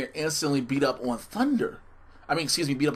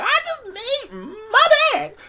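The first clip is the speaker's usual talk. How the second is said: very high, loud shrieking mother voice; Martin's mama voice; 8 dB louder than usual. Retold or imitated. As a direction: shriek it like a frantic sitcom mom. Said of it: "made Lynn sound like Martin's mama".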